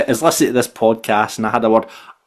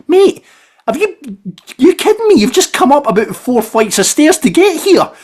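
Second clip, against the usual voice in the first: incredulous; 13 semitones higher, 4 dB louder than usual. Retold or imitated. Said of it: "I was like".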